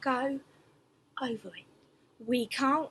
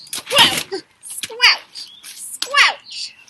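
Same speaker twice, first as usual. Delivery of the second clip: climbing louder and higher squelches; squelchy sound words; 7 semitones higher, 11 dB louder, much slower than usual. A narrator's reading. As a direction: make each squelch bigger. Mud sound words acted out.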